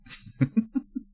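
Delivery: short high giggle; a quick squeaky laugh. Real, not acted. Laughing at own story.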